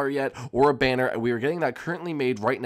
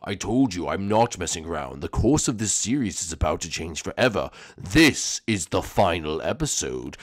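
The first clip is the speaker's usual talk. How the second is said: much lower, slow, menacing villain drawl; low sinister villain; 7 semitones lower than usual. Acted. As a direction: purr it out like a creepy mastermind. Villain announcement.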